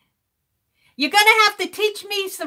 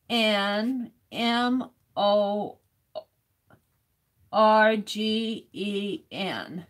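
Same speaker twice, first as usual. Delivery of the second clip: letters spelled slowly and softly, one by one; slow spelling out; 4 semitones lower, 5 dB quieter, much slower than usual. Real, not acted. Streamer copies the spelling, slow and quiet. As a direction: spell it out as you write. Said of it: "spelling while writing".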